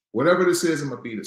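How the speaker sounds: loud, defiant vow; defiant declaration. Retold or imitated. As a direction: say it like a vow to win. Defiant resolve.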